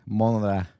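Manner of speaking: New York "Monitor." with a flapped t; New York accent. Retold or imitated. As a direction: mimic his "Monitor." as "monader". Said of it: "I like how you said monitor".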